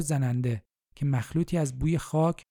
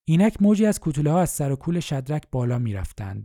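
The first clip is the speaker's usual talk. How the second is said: ordinary delivery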